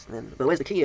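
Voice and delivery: ordinary delivery, nothing marked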